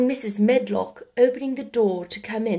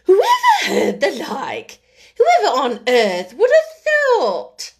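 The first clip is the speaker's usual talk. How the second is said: much higher, louder, slow astonished Yorkshire woman; 9 semitones higher, 8 dB louder, slower than usual. A narrator's reading. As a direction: gasp it out in broad Yorkshire. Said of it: "speaking rather broad Yorkshire herself".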